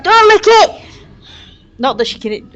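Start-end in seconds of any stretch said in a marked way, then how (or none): 0.0-0.8 high, loud warning cry; in-character shout. Acted. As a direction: shout it out as an urgent warning, half laughing. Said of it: "I'm gonna shout".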